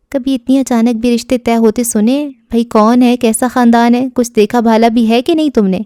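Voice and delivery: plain reading voice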